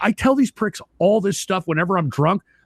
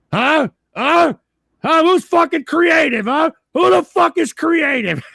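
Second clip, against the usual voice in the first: gloating taunt; 8 semitones higher than usual. Real, not acted. Gloating at trolling chat.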